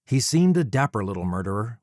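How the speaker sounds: ordinary delivery, nothing marked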